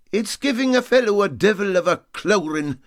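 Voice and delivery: ordinary delivery, nothing marked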